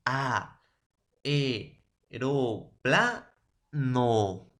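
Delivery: "Aeroplano" drawn out slowly, each vowel apart: a-e-ro-pla-no; "Aeroplano" dictated very slowly, vowels split; syllabified demo. Shown as a demonstration. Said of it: "Ahí está, jóvenes".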